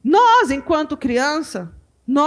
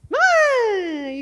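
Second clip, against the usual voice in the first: put-on child calling mom; 8 semitones higher than usual. Child cries when mother eats.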